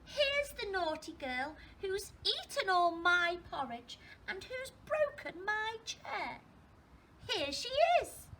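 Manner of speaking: high, loud tiny voice; high-pitched little bear telling on someone; Baby Bear. Acted. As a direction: squeal it accusingly like a little cub. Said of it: "tiny little voice as loud as he could".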